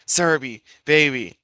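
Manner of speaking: ordinary delivery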